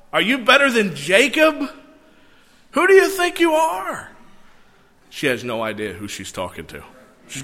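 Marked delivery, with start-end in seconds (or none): 0.1-4.1 high-pitched, indignant scoff; incredulous, climbing sharply higher on the last question; sarcastic challenge. Acted. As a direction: challenge him as if he has some nerve. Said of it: "I think there's a twinge of sarcasm".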